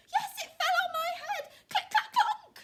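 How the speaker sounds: very high, loud chick squeal; tiny hysterical bird; panicked chick. Acted. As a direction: squeal it in frantic excitement.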